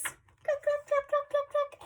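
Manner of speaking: very high staccato clucks; clucky chicken. Acted. Reader shows children a chicken's hello, high clucking. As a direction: cluck it squeaky like a flustered hen. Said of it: "is flappy and clucky".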